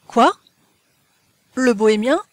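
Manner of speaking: astonished, incredulous questions; a touch of startled surprise; surprised exclamation. A narrator's reading. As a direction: exclaim in mild disbelief. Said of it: "s'écria-t-il".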